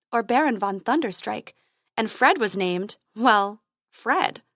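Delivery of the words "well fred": usual speaking voice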